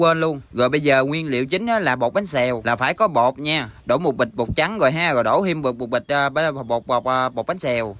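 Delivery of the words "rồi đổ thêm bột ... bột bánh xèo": stumbling over the word bột; stammering. Real, not acted. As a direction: get stuck repeating bột, hesitating before finishing. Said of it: "cà lâm luôn". (nói cà lâm, lắp bắp)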